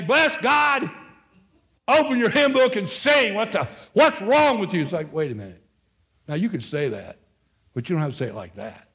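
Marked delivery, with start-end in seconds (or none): 0.0-4.8 much higher, louder, barking the order at the congregation; mock tirade at a slow congregation; harsh scolding. Shown as a demonstration. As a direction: snap it out like a harsh, impatient preacher. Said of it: "it's not what you say, it's how you say".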